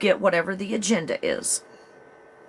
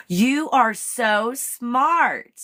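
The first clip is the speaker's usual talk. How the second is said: put-on slow, high, gushing Valley Girl; 7 semitones higher, 5 dB louder, much slower than usual. Vlogger gushing as Chat GPT in Valley Girl voice. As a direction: coo it like a flattering Valley Girl. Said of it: "in a Valley, Valley Girl tone".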